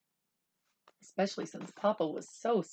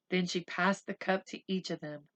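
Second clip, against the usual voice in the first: usual speaking voice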